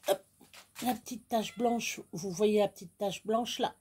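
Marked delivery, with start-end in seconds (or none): none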